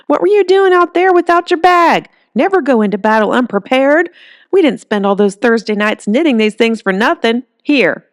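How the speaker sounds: put-on a fierce old woman telling off her girl; scolding granny.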